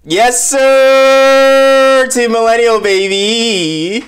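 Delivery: high, loud hype shout; excited cheer. Real, not acted. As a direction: yell it like a proud fan. Cheering for his generation.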